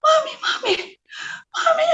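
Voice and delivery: high, tearful calling; crying with joy. Retold or imitated. Running to mother after the dream, joyfully tearful. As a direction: call out in a high joyful sob. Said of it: "nangis dengan sukacita".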